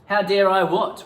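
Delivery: high, loud indignant comeback; indignant retort. Pretend. Actor retorting to partner's accusation, loud and indignant. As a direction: fire it back, offended. Improvised quarrel, indignant reply.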